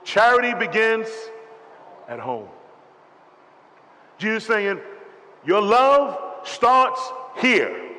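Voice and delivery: loud, high fervent preaching; preaching at full force. Acted. Pastor pressing the church about loving its own, loud and high. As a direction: drive it home to the congregation.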